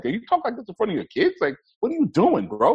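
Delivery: high-pitched, incredulous scolding; Tyler Perry. Retold or imitated. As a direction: imitate a friend calling out his cursing. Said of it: "and Tyler was like".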